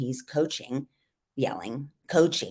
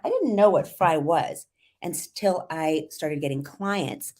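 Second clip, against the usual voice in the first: vocal fry; slower than usual. Shown as a demonstration. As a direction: let the voice creak into fry. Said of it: "I'm exaggerating it when I speak like this".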